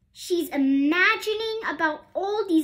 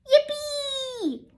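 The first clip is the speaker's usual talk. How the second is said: high, shrieking cheer; an overjoyed girl cheering at a chance; yippee; 12 semitones higher than usual. A narrator's reading. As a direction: squeal it with pure joy. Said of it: "says Ruby Lee, hopping about".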